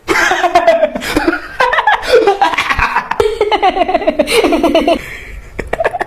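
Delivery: long helpless burst of laughter. Acted. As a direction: fall about laughing. Laughter erupts again.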